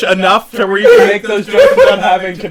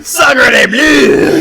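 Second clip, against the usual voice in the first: hammed-up French accent, drawn out; mock French outrage; 3 dB louder than usual. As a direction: cry it out like an offended Frenchman in a hammy French accent. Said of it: "The Europeans are gonna".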